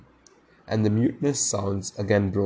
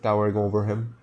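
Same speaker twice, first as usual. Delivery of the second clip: ordinary delivery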